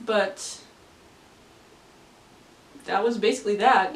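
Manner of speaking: normal talking voice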